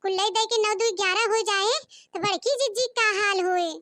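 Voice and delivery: usual speaking voice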